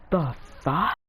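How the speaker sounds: drawn-out, halting curse rising at the end; acted disbelief. Jiraiya hearing Naruto already summons three animals, incredulous. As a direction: split the words, lift the last in disbelief.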